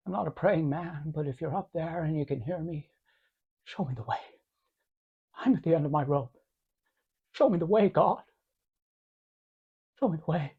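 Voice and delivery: soft, broken prayer to God; hushed prayer. Acted. George prays alone for help, soft and desperate. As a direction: pray quietly at the end of your rope.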